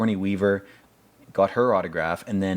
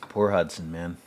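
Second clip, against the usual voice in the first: usual speaking voice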